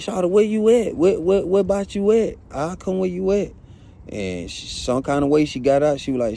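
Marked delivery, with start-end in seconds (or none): 0.0-3.5 higher, urgent, stumbling questions. Retold or imitated. Asking his sobbing girlfriend where she is, higher and urgent. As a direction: demand to know where she is. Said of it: "So I'm like".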